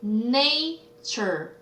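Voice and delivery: "nature" stretched as na, then ture; syllable by syllable. Shown as a demonstration. Teacher answering a vocabulary question, "nature" drawn out by syllable. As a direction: slowly sound out both halves of "nature". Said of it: "뭐예요?".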